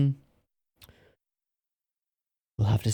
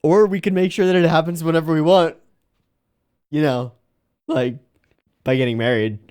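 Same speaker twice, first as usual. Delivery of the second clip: excited; 6 semitones higher, 5 dB louder than usual. Real, not acted. Teasing characters about marriage.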